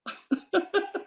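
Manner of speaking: high burst of laughter; laughing hard at his own story; big laugh. Real, not acted. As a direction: break into a big laugh at the punchline.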